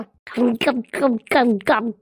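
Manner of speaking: low, repeated voiced glugs; exaggerated glug-glug of downing liquor; mimed gulping noises. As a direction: fake drinking with short throaty gulps. Drinks again, imitated gulps.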